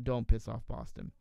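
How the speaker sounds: usual speaking voice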